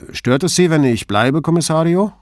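lightly voiced embarrassed boatman, rising at the end; slightly embarrassed. A narrator's reading. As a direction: ask the boss a favour, a little sheepishly. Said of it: "wirkte ein wenig verlegen".

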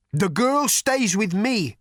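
loud, high, slow declaration; commanding thunder god. A narrator's reading. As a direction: proclaim it like a god, slowly. Thunder God claims the girl.

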